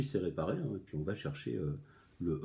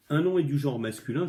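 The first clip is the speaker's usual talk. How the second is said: higher, louder textbook reading; reading the lesson aloud; 5 semitones higher, 6 dB louder than usual. Shown as a demonstration. Teacher reads the grammar lesson to pupils, reading voice. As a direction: read the rule out from the book. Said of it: "je lis la leçon".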